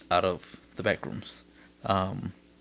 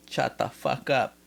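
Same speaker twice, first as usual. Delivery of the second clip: cartoon kid voice; 3 semitones higher, 5 dB quieter than usual. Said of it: "And the guy says".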